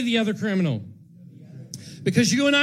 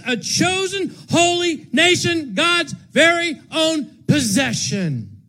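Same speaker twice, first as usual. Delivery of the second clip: higher, louder, slowing at the end; preaching proclamation; 10 semitones higher, 5 dB louder than usual. Acted. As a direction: declare it over the criminal.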